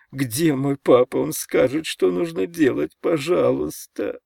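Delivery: tearful, high-pitched begging; whining five-year-old. A narrator's reading. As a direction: whimper like a lost little boy begging for help. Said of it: "плаксивым голосом пятилетнего ребёнка".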